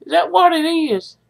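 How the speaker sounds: ordinary talk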